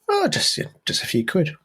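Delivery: high vicar voice on the first words. Acted. Vicar naming a cheap price, in a high voice. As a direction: start up high like the cheerful vicar.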